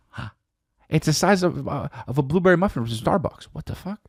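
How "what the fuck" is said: ordinary delivery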